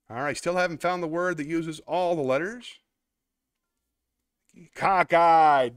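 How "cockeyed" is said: triumphant. Real, not acted. Finds the word, triumphant.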